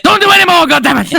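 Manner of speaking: high, exasperated yell; mock fury. Acted angry order to stop.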